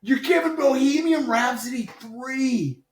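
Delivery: high, loud squeal of disbelief; mock outrage. Outraged at favourite ranked last.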